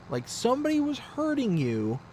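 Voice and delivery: high-pitched disbelief; exasperated husband; incredulous. Retold or imitated. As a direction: protest the absurdity, pitch climbing. Incredulous husband scolds wife.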